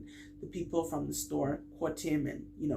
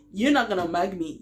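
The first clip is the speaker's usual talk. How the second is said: defiant retort; 2 semitones higher, 7 dB louder than usual. Retold or imitated.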